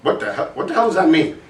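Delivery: normal talking voice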